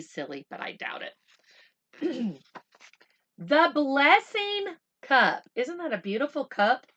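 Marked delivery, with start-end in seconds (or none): 3.4-5.4 slow, higher and louder title read; title announcement. Shown as a demonstration. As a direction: present the title grandly. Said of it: "announces book title".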